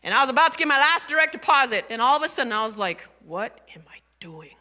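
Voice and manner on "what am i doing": hushed, half-whispered inner worry; anxious thoughts. Retold or imitated. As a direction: softly fret through racing worried questions. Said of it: "You know how the mind goes".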